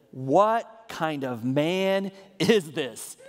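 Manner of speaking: drawn out slowly, each word weighed; slow awe. Acted. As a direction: let the wonder stretch the words. Disciples' awe, read slowly.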